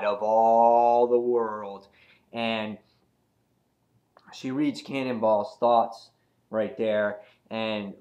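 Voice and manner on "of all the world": drawn-out, slow grandiose phrase; slow, mock-majestic delivery. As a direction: stretch it out like a self-declared god. Mocks Emma's godlike self-importance.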